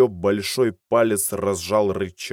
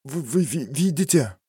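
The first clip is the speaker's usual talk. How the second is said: frightened stutter, rising; stammering in fear; 6 semitones higher than usual. Acted. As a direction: trip over the words in panic. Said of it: "заикнулся мужчина".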